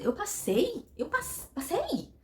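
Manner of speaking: high-pitched disbelieving question; incredulous. Acted. Candidate hearing she passed the interview, incredulous. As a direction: ask it as if you can't believe your luck. She hears she got the job.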